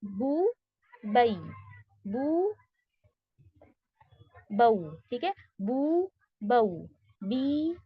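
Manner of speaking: बू held long, बऊ a bit shorter; बऊ just short of two counts; lean versus madd drill. Shown as a demonstration. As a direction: contrast बू and बऊ slowly. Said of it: "मद से थोड़ा सा शॉर्ट रहता है".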